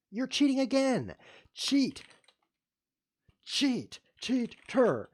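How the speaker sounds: high, loud accusing yells; furious shout. Real, not acted. Angry at the cheater, shouting accusations. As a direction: hurl the word cheat at him.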